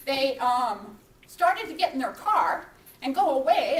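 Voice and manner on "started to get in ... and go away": plain reading voice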